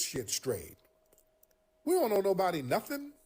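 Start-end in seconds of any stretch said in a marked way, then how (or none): 1.8-3.1 emphatic rant. Real, not acted. Ranting to stream.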